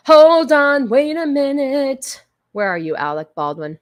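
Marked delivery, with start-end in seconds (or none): 0.1-2.2 excited hold on. Real, not acted. Scrambling to find the link.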